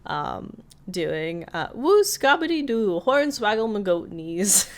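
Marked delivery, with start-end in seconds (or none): 1.7-4.7 high, loud hillbilly gibberish with a laugh; giddy old prospector babbling, rising then easing off; McGucket babble. Retold or imitated. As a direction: cackle out nonsense like a wild old coot. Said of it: "McGucket appears, doing".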